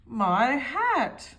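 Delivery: my hat is ruined, dismayed cry. A narrator's reading. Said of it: "cried Mister Uppity".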